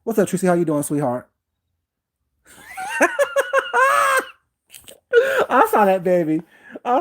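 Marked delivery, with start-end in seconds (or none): 2.8-4.2 sudden high burst of laughter; real laugh. Real, not acted. Laughs at a chat comment.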